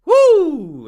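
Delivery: high, loud "woo" sliding down; hammed-up whoop of disgust; mock stink whoop. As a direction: let out a big falling whoo at a stench. Reacting to an imagined stink.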